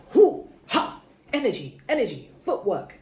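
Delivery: punchy hoo-ha, then bouncy chant; amped up. Retold or imitated. Reader showing viewers her new energy, playfully amped up. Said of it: "getting more amped up".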